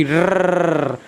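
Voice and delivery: long rolled-tongue engine trill "रर्रर्र"; childhood car noise. Shown as a demonstration. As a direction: imitate a toy car with a drawn-out trilled R. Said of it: "गाड़ी चलाई होगी". (बचपन वाली गाड़ी की रर्रर्र आवाज़)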